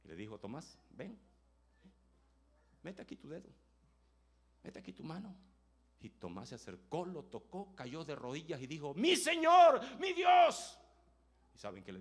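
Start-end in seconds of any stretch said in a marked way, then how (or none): 0.4-5.4 softer, tender call; gentle invitation. Acted. Jesus softly inviting doubting Thomas to touch him. 8.9-10.8 high, loud cry of worship; awed shout. Acted. Thomas on his knees crying out to Jesus, high and loud.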